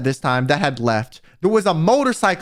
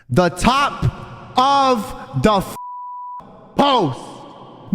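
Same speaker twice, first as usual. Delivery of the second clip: exasperated; 3 semitones higher, much slower than usual. Real, not acted. Exasperated rant at badly built fence.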